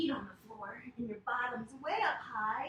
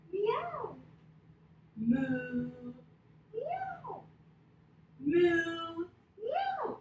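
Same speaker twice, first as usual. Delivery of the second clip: alternating moo and meow; 6 semitones higher, 4 dB louder, much slower than usual. Shown as a demonstration. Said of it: "We'll start in cow".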